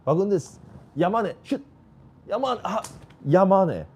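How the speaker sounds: rising excited calls of a fan; excited shouting. Real, not acted. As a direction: cheer the attack on, loud and high. Live chance, urging the shot.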